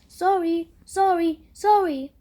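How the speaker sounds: loud high repeated sorry; frantic apology. A narrator's reading. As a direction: apologize in a panic. Doctor apologizing after jabbing boy.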